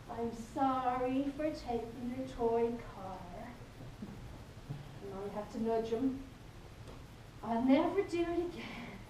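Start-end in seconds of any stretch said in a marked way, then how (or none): 0.1-3.5 slow, sing-song, rhythmical apology; lightly voiced three-year-old reciting, insincere; sing-songy child. Little boy reciting his apology to his brother, sing-song. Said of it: "in his sing-songy, rhythmical voice". 7.4-9.0 rhythmical, singsong pledge, a touch higher; lightly voiced toddler reciting a promise; sing-songy child. Little boy promising his brother, mock sing-song. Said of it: "in his sing-songy, rhythmical voice".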